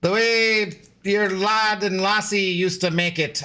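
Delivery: mock Irish brogue selling butter; terrible Irish accent. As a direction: ham up a bad Irish lilt. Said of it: "just a terrible, terrible impression".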